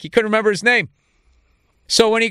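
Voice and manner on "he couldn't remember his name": ordinary talk, nothing marked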